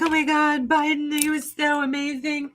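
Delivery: lightly hammed-up gushing fan; mock gushing fan. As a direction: gush like a dazzled supporter. Imitating Biden fans gushing.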